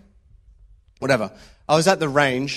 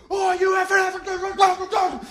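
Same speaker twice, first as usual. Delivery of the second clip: much higher, screaming tirade; supervisor blasting him. Retold or imitated. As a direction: scream at the soldier who fumbled his rifle. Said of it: "absolutely blasted him".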